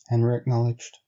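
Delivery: ordinary delivery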